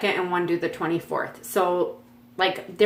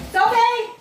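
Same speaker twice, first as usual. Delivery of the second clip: calling out. Real, not acted. Calling dog from downstairs.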